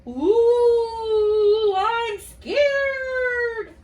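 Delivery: high, loud mock fright; scared monster. Teacher acting the worried orange monster, mock scared. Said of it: "act like the orange monster".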